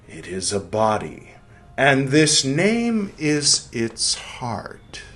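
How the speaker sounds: slow, weighty reading aloud; reading a quote. Acted. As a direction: savor each word of the quote. Reads a quotation aloud.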